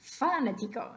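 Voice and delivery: fanático wrongly stressed FÁnatico; fanático mis-stressed, FA strong and the rest light; stress on first syllable. Shown as a demonstration. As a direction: punch the opening "fa" of fanático. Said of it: "What about the first one?".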